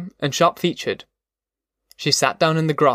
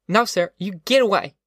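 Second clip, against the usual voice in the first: higher-pitched, mildly acted angry girl; defiant refusal; 6 semitones higher than usual. A narrator's reading. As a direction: refuse him in raised-pitch indignation. Girl angrily rejects Al.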